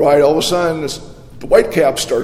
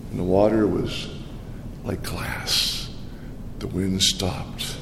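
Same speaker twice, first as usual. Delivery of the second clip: hushed narration; 7 semitones lower, 9 dB quieter, slower than usual. A narrator's reading. Narrating sudden calm.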